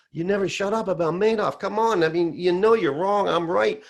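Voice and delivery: high, loud, fed-up mock scolding; exasperated retort. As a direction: imitate a friend brushing off the argument. Acting out friend's exasperated retort.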